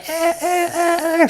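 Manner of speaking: high, imitating a girl crying out; mock crying screams. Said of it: "cries again, screams".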